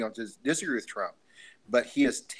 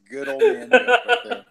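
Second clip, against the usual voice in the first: real laughter through the words, higher and louder; laughing; 11 semitones higher, 9 dB louder than usual. Real, not acted. Friend reacting to the punchline, laughing loudly. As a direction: crack up while praising the joke. Laughing after hearing the joke.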